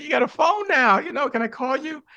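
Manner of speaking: very high "you got a phone now"; high eager ask. Retold or imitated. Asking the woman for her number, eager high voice. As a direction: pitch up, pouncing on the chance.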